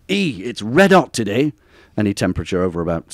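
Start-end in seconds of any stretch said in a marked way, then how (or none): 0.1-1.5 drawn-out northern English exclamation, pitch swinging. Acted. A northerner exclaiming about the heat, northern English accent. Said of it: "The way northerners say E".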